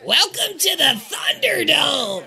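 high, strained announcer scream. Acted. Game master as crazy host on a box, screaming. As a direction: scream it slow and drawn out to a crowd. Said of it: "and he screams".

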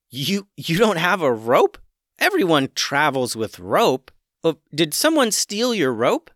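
can't believe it; boy shocked the chicken has no rope; incredulous boy. Acted.

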